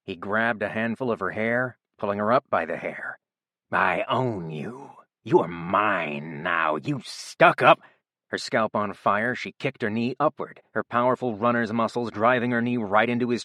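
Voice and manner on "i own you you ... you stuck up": menacing growl. A narrator's reading. Abuser threatening pinned victim.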